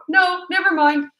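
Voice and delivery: quick retreat in an imitated child's voice; kids backing down. Children to the parent threatening to tell Mom, mock backing down.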